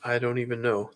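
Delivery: normal talking voice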